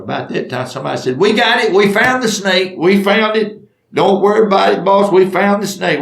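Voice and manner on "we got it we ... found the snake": high, loud announcement of a find; raised, pitched-up hollering; acted excited shout. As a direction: call the news out to the boss.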